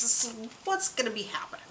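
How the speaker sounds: ordinary talk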